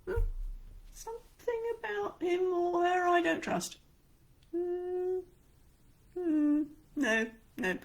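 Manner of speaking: high, doubting murmur; distrustful audience. Retold or imitated. Audience member doubting an insincere speaker, suspicious. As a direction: sound unconvinced and wary. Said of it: "your audience is gonna pick up on it and go".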